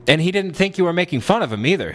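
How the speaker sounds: ordinary talk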